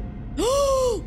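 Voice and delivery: high, sharp gasp of fright. Real, not acted. Streamer startled in the dark basement, sharp gasp. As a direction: gasp as something jumps out.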